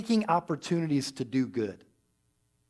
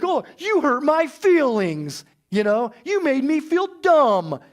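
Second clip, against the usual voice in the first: higher, louder, slow whiny pout; mock hurt whine; 8 semitones higher, 5 dB louder than usual. Preacher mocks petty hurt feelings with a slow, whiny pout. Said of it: "injured self-concern".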